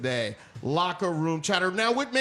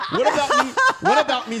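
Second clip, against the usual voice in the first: laughing; 9 semitones higher, 6 dB louder than usual. Real, not acted. Laughs while asking.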